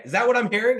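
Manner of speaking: taunting jab. Retold or imitated. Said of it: "he's like".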